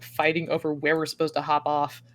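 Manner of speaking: usual speaking voice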